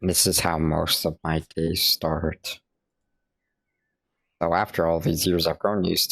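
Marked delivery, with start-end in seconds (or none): none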